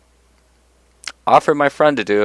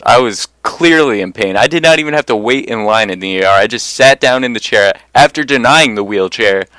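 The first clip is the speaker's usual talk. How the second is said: ordinary delivery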